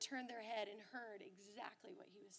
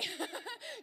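high, bright burst of laughter; delighted laugh; 8 semitones higher than usual. Real, not acted. Mom laughing at herself.

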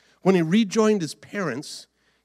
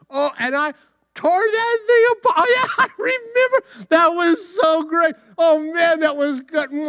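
high-pitched, giddy Samson; hammed-up delighted excitement; gleeful reminiscing; 10 semitones higher, 5 dB louder than usual. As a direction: relive the triumph while savoring a treat. Samson relives victory, eats honey.

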